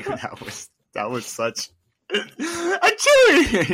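squeaky laugh through the words; laughing too hard to speak. Real, not acted. Laughing at partner's sneeze.

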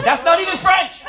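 high, loud, laughing mock retort; sister's exasperated retort. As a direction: squeal it like the annoyed sister. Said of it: "and she goes".

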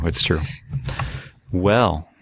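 usual speaking voice

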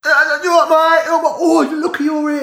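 very high, loud put-on cooing; gushing relative. Co-host mimicking a relative fussing over ears, high mock gushing. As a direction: coo shrilly over them like a fussing relative.

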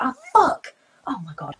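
high yelped curse, then a hushed regret; mock dismay. Retelling cursing by accident near children, mock dismay. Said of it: "I could have children around me".

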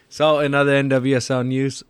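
ordinary talk, nothing marked

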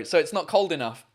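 ordinary talk, nothing marked

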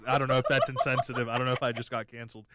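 ordinary delivery

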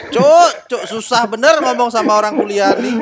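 laughing, loud and high; real laughing protest; laughing exasperation. Real, not acted. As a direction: crack up while complaining.

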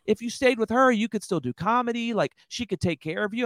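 high mocking heckler voice, lightly done. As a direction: toss it up high like a taunting crowd. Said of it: "everybody's talking at me saying".

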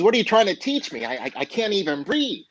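high, quick, stammering objection; mock breathless protest. Young man pulled from the water to older man, mock breathless protest. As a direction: play a frantic pupil without real gasping. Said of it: "he's gasping for breath".

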